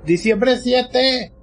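high, drawn-out cry of excitement; excited yelling. Real, not acted. Fan reading the release date, high drawn-out yell. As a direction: stretch the words out slowly, pitched high. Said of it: "de tanto que gritas".

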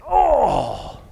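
sharp high yelp of being kicked in the gut; sudden loud gut-punch yelp; pained grunt. Acted. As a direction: let out a loud winded cry. Farmer kicks lawyer in gut.

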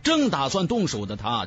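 usual speaking voice

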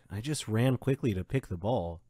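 ordinary delivery

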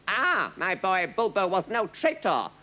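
crying out. A narrator's reading. King learning of his son's loyalty, crying out. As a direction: cry it out with pride. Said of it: "cried Padella".

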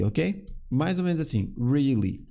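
ordinary talk, nothing marked

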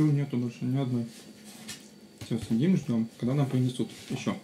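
ordinary talk, nothing marked

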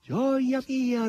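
high, gleeful squeal; squealing with delight; boisterous squeal. A narrator's reading. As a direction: maid squealing with joy. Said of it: "ridestando con i suoi schiamazzi".

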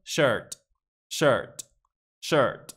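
"shirt" with the R colouring the vowel; American R pronunciation. Shown as a demonstration. Teacher shows the American version, R-coloured shirt. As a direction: sound the R in "shirt". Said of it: "con la R muchas veces se esconde el sonido".

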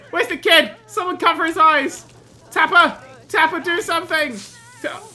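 high, loud urgent cries; panicked shouting. Acted. Player shouting to Tapper about the kid, panicked. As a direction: yell for someone to step in right now. Shouting orders during the fire.

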